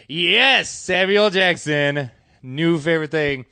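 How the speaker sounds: loud, high, drawn-out cheer; excited fan cheer. Retold or imitated. Anime fan learning his favorite actor likes anime, excited cheer. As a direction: re-enact a delighted fan's triumph. Fan retells delighted reaction to interview.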